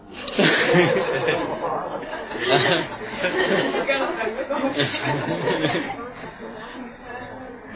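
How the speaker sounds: hearty drawn-out laughter; a long real laugh. Real, not acted. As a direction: laugh it out at your own joke. Long laugh at own story.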